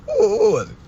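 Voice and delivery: very high squealed question; incredulous squeal. Real, not acted. Man teasing listeners after laughing, high incredulous squeal. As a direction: squeal it right after cracking up.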